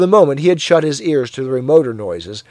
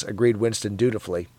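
plain reading voice

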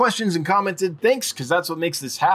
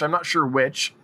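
usual speaking voice

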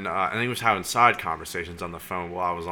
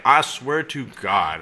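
slow, higher and louder, swearing innocence; emphatic retold protest; 5 semitones higher, 6 dB louder, much slower than usual. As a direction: stretch each word out in insistent protest. Protesting innocence when accused of taking boat.

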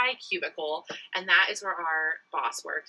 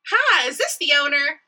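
higher, louder flirty phone opener; sexy sales pitch voice; 5 semitones higher, 6 dB louder than usual. Shown as a demonstration. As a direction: sell it like a flirty telemarketer. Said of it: "I'm gonna do it for you now".

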